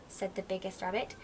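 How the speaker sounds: ordinary delivery, nothing marked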